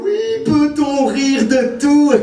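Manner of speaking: high, stretched-out mock question; mocking parroting. Comic parrots a tired debate question, high and mocking. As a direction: drag it out in a silly high voice.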